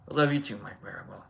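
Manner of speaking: ordinary delivery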